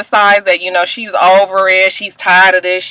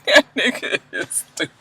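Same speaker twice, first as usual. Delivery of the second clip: laughing; 8 dB quieter than usual. Real, not acted. Laughing at Apollo.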